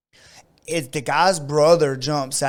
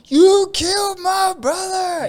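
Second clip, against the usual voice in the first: high, slow mock holler; loud wailing accusation, play-acted for laughs; retold yell; 6 dB louder, slower than usual. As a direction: imitate the brother's drawn-out scream. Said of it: "yelling at him".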